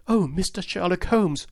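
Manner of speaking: high, eager cry of relief to the detective; excited cry. Acted. Young woman greeting Holmes, an excited high cry. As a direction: call out his name, overjoyed. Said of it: "she cried".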